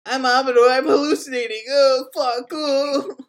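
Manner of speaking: high, loud mocking whine of a stumbling intro; mocking Amador. [name] teasing Amador's botched intro, high mocking whine. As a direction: parody a friend botching his name. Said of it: "you ruined it with your".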